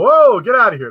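loud, high startled yelp at a dog; startled shout. Retold or imitated. Shooing a frisky dog off, startled loud shout. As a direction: shoo the dog away, loud and sharp.

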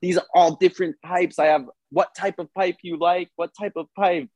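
retelling a smoke shop fight, imitating the angry clerk; mock angry clerk accent. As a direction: play the furious clerk with a put-on accent. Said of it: "started screaming at each other".

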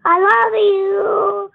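slow and loud. Real, not acted. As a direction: stretch it out slowly and loudly. Child says it again.

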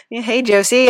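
normal talking voice

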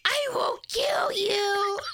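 high, slow breathy threat; mock menacing whisper. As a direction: hiss it like a movie villain.

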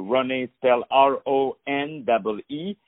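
letter by letter, R O N E E; spelling it out. Shown as a demonstration. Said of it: "Let me spell it for you".

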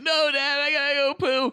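put-on high, whiny kid begging his dad; pleading child. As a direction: plead like a desperate little kid. Child begging father urgently.

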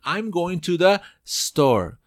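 slow and careful, store with a clean s, no added e; correct store. Shown as a demonstration. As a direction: store said with no vowel before the s. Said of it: "se dice".

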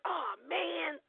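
high, soft teary whimper; overwhelmed kid near tears; mock crying. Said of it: "I was like".

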